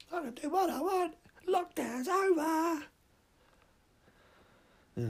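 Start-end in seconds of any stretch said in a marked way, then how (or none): 0.1-2.8 very high, slow manic gloat; crazed cackling maniac. Acted. Chainsaw man in the cinema gloating, very high and slow. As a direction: gloat like an unhinged villain.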